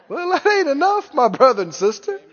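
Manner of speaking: usual speaking voice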